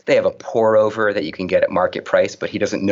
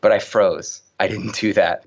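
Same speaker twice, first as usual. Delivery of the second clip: ordinary talk, nothing marked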